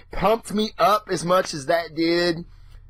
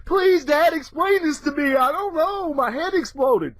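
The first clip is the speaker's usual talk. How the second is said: overwhelmed plea; 11 semitones higher than usual. Retold or imitated. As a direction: kid pleading with dad, mind blown. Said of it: "You know, like".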